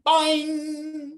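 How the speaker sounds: high cartoon boing; bright springy boing; boing sound effect.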